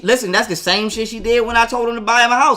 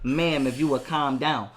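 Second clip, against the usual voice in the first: lower, slow, patient appeal; calm; 5 semitones lower, 4 dB quieter, slower than usual. Retold or imitated. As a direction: stay measured under her tirade. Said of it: "trying to be calm with her mother".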